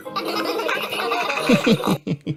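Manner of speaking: high-pitched giggly laughter; children's giggling. A narrator's reading. Belinda and Peppa laugh together at the porridge joke, giggling. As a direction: giggle like two little friends sharing a joke. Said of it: "Belinda and Peppa laughed together".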